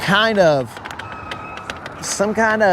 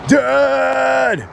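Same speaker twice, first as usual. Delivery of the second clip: high yelled Dad!; bratty kid's final yell for dad; 6 semitones higher than usual. Pretend. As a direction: shout it like a pestering child. Kids pestering him, acted.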